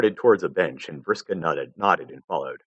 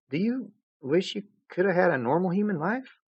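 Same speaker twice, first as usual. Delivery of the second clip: gentle question; 12 semitones higher than usual. Acted. Jade asks gently.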